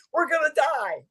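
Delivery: a hint of the oracle's warning. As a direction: oracle foretelling doom. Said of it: "the Oracle says".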